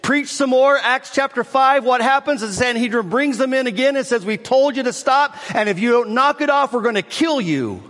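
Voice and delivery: high, loud revival preaching, driving the story forward; preaching at full force. Acted. Pastor fires up the congregation retelling Acts, full-force preaching.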